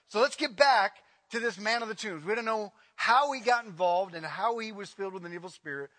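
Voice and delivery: ordinary delivery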